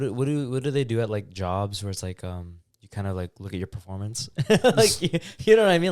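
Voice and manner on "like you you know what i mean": laughing. Real, not acted. Laughing mid question.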